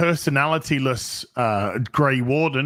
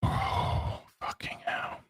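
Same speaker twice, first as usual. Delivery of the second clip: whispered, fed-up curse under the breath; real whispered groan of disbelief; exasperated whisper; 10 dB quieter, slower than usual. Real, not acted. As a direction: mutter it in disbelief, barely voiced. Exasperated aside after paella joke.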